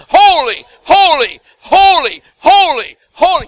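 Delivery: high, very loud cry over and over; repeated shout. Shown as a demonstration. Preacher showing how seraphim cry again and again, shouted. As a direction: shout each one, falling at the end. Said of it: "Again and again".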